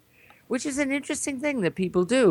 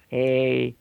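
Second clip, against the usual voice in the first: normal talking voice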